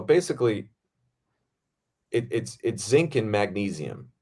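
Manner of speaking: normal talking voice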